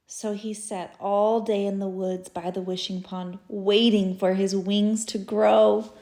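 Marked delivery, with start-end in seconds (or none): none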